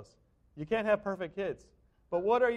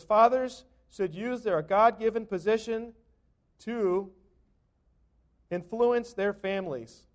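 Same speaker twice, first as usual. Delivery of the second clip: slow emphatic reading; 3 semitones higher, 6 dB louder, slower than usual. Shown as a demonstration. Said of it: "It says".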